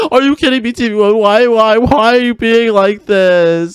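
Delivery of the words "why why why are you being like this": usual speaking voice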